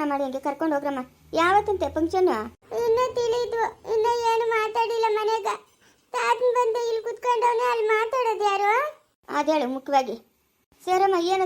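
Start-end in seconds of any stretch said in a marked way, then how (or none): 2.8-9.0 put-on high, childlike complaining voice; little granddaughter. Granddaughter telling grandma her grandpa stays away, childlike whine.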